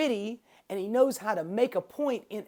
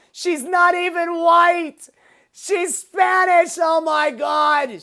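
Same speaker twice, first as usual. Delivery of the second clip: Bill Burr impression; 8 semitones higher, 9 dB louder than usual. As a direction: rant like a mocking comedian, shouting the outrage. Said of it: "his voice".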